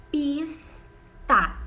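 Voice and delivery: "Pista" split as pis with a drawn-out S, pause, ta; syllable by syllable. Shown as a demonstration. Teacher showing pupils the second sound, "Pista" broken into syllables. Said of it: "depois saiu outro som da minha boca".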